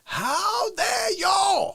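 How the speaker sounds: high, slow, drawn-out outraged cry; How Dare You guy. Retold or imitated. Streamer imitating the meme line for chat, high slow mock outrage. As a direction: stretch out the outrage. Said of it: "everyone's like".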